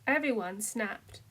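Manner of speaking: ordinary delivery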